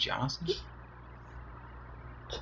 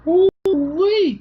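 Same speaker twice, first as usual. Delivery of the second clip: high drawn-out ooh-wee; playful squeal; 6 dB louder than usual. Real, not acted. Playful exclamation to baby.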